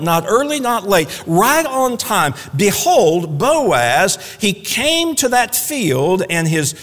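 ordinary talk, nothing marked

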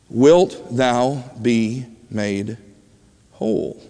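very slow, word by word; solemn question. Retold or imitated. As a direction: ask it gravely, letting each word stand alone. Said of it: "He's asking him a very serious question".

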